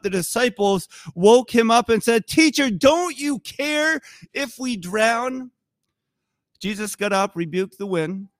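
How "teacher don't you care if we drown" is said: high, drawn-out appeal; distressed plea. Acted. Disciples waking Jesus in the storm, distressed plea. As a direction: plead it slowly, pitched up high.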